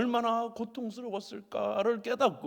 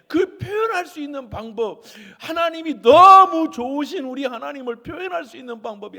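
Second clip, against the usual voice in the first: tearful; 6 semitones higher, 13 dB louder than usual. Real, not acted. Preacher's emotional climax.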